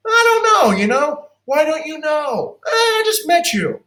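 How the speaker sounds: high, doubtful client voice; high skeptical client voice. Retold or imitated. Playing a doubtful client to a new manager, high skeptical voice. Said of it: "They'll say, like".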